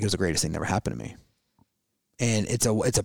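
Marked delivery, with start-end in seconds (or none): 0.0-1.2 quiet rushed quote. Retold or imitated. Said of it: "he's like".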